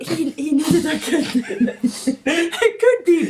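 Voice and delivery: barely holding in a laugh; laughing while telling. Real, not acted. Telling co-host an awful rumour while laughing. Laughing at George Lopez story.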